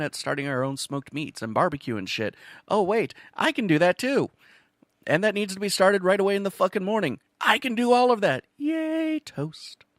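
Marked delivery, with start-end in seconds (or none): 2.7-8.4 higher and louder, faster, hammed-up eagerness; mock-eager volunteer pitching himself to the chef. 8.6-9.8 much higher mock yay, toast; a squeal of fake delight; hammed-up glee over toast; mock cheer.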